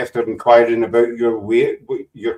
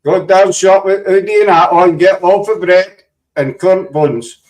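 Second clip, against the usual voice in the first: put-on English accent; 6 semitones higher, 5 dB louder than usual. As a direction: ham up an English accent to mock the hoodie. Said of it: "that's my English accent there".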